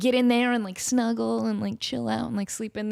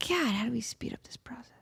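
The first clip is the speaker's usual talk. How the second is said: whispered aside; 8 dB quieter than usual. Real, not acted. Joking aside about cat age.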